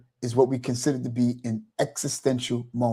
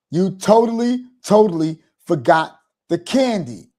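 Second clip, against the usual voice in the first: upset wife; 6 semitones higher, 5 dB louder than usual. Retold or imitated. Wife confronts husband over candy.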